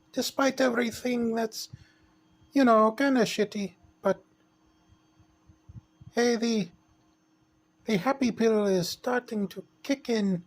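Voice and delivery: high, sweet little princess voice. Pretend. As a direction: play a cheerful make-believe princess.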